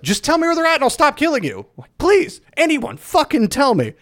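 high, loud acted plea; exasperated pleading. Game hero begging the goons he's killing, exasperated high plea. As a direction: beg in mock desperation, voice pushed high.

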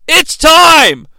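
loud, high announcer-style holler; punchy impersonated yell; Cowboy Bill Watts. Retold or imitated. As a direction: shout it as a rousing wrestling catchphrase. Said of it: "as Cowboy Bill Watts would say".

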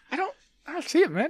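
high squeaky imitation; high squeaky mock voice. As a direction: squeak out the denial. Echoing the imitation.

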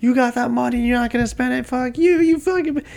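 high-pitched imitated angry outburst; mock a pissed woman's high, cursing rant. Pissed-off girl cursing out Rocky after he refuses, imitated in high pitch. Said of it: "she gets pissed".

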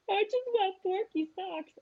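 put-on whiny little boy. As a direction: play the four-year-old pleading for socks through tears. Mom voicing crying son Henry.

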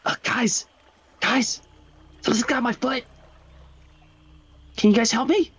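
high, strained plea for help; high frightened call to the party; Riley's panicked voice. Acted. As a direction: squeak it out like a stuck, scared kid. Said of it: "And he's like".

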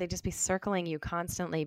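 normal talking voice

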